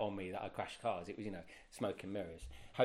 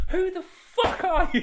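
high, loud, slow laughing "who are you"; shrieking with laughter, imitating the bosses; laughing mock outrage; 16 dB louder, slower than usual. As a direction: squeal it through a laugh. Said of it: "they were like".

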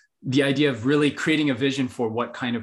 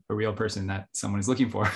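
ordinary delivery

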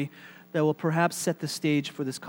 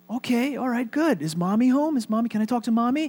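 put-on higher voice, coaxing a small child; telemarketer to child; 6 semitones higher than usual. Telemarketer asks little boy for Mommy, coaxing and high. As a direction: ask for the grown-up, pitched up.

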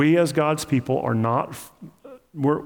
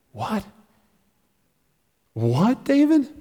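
incredulous; 10 semitones higher than usual. Acted. Preacher's disbelief at David.

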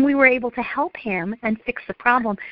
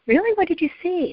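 approachable parent; 9 semitones higher than usual. Acted. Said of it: "now I can say".

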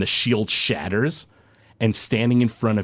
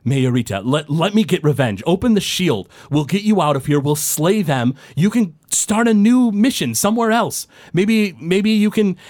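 higher, urgent pleading woman; a hint of a desperate dwarf woman; 4 semitones higher than usual. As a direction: beg to be let out for revenge. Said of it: "dwarf woman says".

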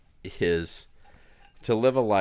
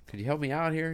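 higher-pitched call for help, lightly acted; mock yell up from a hole; 10 semitones higher than usual. Man in hole calls up to passing priest, mock yell. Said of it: "he yells up at the priest".

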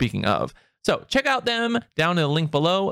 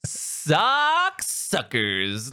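drawn-out announcement; much slower than usual. Acted. Drawn-out sponsor name.

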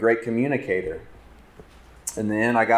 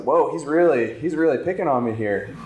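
retold surprise; 6 semitones higher than usual. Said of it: "And I was like".

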